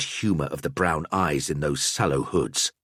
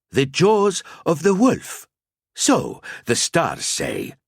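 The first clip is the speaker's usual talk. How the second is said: higher and louder, ringing pronouncement; prophetic old seer; 10 semitones higher, 4 dB louder, slower than usual. A narrator's reading. Al-Azir reads the stars for the knights, high and ringing. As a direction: intone it like a mystic.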